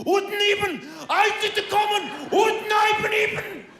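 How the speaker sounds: high, shouted garbled rock-star slur; mock rock star mumble. Comedian mimicking a frontman slurring to a huge crowd. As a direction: yell nonsense like a frontman to a stadium.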